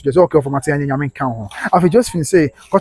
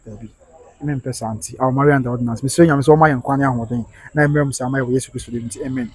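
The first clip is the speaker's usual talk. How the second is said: soft earnest prayer; 4 semitones lower, 6 dB quieter than usual. Real, not acted. Speech and prayer for couple.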